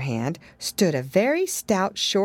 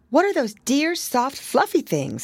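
put-on little girl; 8 semitones higher than usual. A narrator's reading. Lucy voiced.

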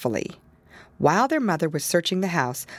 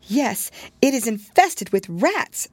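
tearfully; 6 semitones higher than usual. A narrator's reading. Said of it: "said Tabitha tearfully".

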